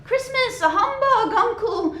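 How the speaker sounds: high, laughing protest; Fred teasing his uncle; amused disbelief. Acted. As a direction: laugh it off as nonsense.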